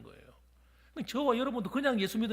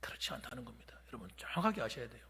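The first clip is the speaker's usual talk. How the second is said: much softer, unvoiced whisper; hushed emphasis; 9 semitones lower, 8 dB quieter than usual. Real, not acted. Pastor leans in to warn the congregation, whispered. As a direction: drop to a whisper to draw them in.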